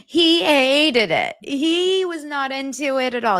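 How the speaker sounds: high laugh into the words. Real, not acted. Vlogger laughing at the groom, slow and high. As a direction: laugh it out, slowly.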